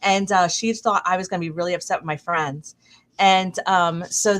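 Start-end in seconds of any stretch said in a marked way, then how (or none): none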